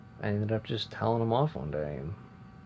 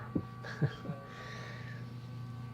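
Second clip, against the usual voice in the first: quiet breathy chuckle; a short soft laugh; 4 semitones higher, 10 dB quieter than usual. Real, not acted. Laughs at his own setup.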